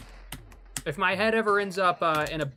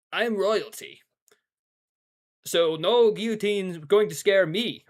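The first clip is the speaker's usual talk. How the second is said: slow, defiant mock-royal pronouncement; lightly played French king; 3 semitones higher, 4 dB louder than usual. French king facing the guillotine, mock defiance. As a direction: stand firm as a king, half-playing. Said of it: "if I was a French king".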